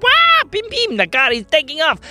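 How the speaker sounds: very high, thrilled cry; amazed exclamation. Acted. Girls as the car takes off, high excited squeal.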